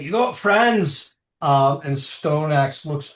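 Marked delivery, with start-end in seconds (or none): 0.0-1.1 dwarf foreman. Acted. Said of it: "he's like".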